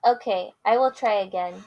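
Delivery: lively picture-book read-aloud with character voices; reading to children. Acted. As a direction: read it big for the kids.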